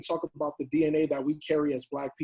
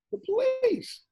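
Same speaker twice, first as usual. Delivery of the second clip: mock reveal; much slower than usual.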